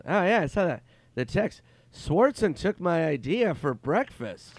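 high, loud, slow impression; Norm Macdonald. Norm claiming his stolen breakfast idea, slow loud impression. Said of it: "he goes".

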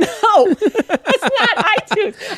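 real laughter breaking into the reply; laughing. Real, not acted. Explaining iTunes to mom through real laughter.

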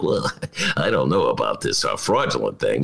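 Ted Cruz impression, nose pinched; low, nasal Ted Cruz impression; pinching nose. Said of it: "the Ted Cruz impression, you hold your nose".

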